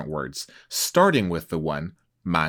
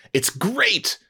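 higher, gushing praise; enthusiastic; 12 semitones higher than usual. Shown as a demonstration. Host showing what a stronger word would sound like, gushing. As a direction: sell it as something great.

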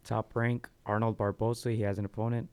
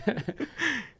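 high laugh. Real, not acted. Laughs at his own joke.

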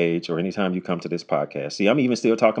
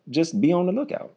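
high, upbeat; 10 semitones higher than usual. Real, not acted. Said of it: "That's all I got for you".

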